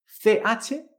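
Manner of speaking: ordinary talk, nothing marked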